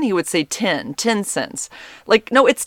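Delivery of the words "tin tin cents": "ten" said as "tin"; imitating mispronunciation. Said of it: "instead of saying ten".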